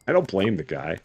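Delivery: normal talking voice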